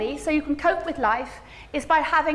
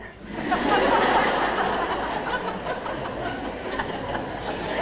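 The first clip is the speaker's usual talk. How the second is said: laughing; 5 semitones higher, 3 dB quieter than usual. Real, not acted. Laughs at own joke.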